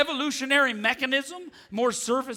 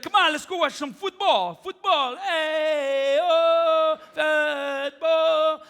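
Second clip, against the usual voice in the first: high, eager invite to watch football; pitched up and keen, calling a buddy to the game; excited call; 6 semitones higher, much slower than usual. Retold or imitated. Excited comic telling his friend to watch football. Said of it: "I was like".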